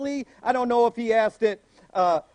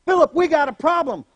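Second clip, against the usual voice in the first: louder, higher call; 5 semitones higher, 6 dB louder than usual. Retold or imitated. Jesus calling Philip again, louder. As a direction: shout it across to Philip.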